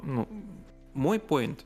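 ordinary talk

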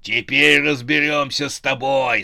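loud menacing yell; threatening shout. A narrator's reading. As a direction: shout it as a challenge to an enemy. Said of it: "закричал он". (грозный крик)

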